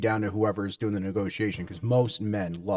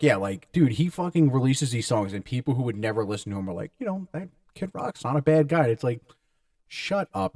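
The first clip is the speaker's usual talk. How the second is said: normal talking voice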